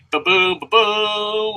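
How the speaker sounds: slow, stretched ba-boom; ba-boom sound effect. Pretend. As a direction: sing out the sound cue. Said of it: "sound effect for adding people".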